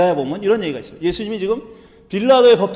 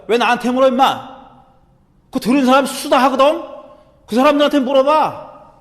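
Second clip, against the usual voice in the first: loud, raised, talking back; defiant retort; 5 semitones higher, 5 dB louder than usual. Acted. Prisoner answering back to the high priest, defiant. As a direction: throw the question back at the interrogator in resistance. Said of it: "저항하는 그런 어투로 얘기한 거예요".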